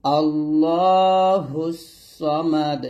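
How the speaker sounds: long drawn আল্লাহু in recitation tune; teacher reciting the verse; slow recitation. Shown as a demonstration. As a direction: stretch আল্লাহু out slowly. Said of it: "তাহলে হবে".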